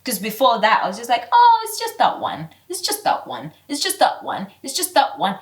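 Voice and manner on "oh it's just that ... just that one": high, singsong mimicry of her old self; breezy high mock of her former excuse; mock naive voice. Mocking her past naive self.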